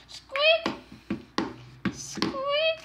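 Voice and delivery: very high squeaky bunny; one piercing little bunny squeak; chocolate bunny squeak. Pretend. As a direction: squeak like a toy rabbit. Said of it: "saying squeak".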